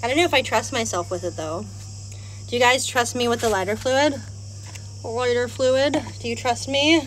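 normal talking voice

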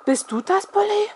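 frightened stammer rising high on the name; scared girl stammering at her pet; stuttering. A narrator's reading. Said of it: "stotterte ich".

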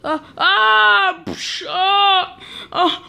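high scream with crash noise; high-pitched mock terror yelps; pretend frightened scream. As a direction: cry out as if scared off. Figures scared by the roar.

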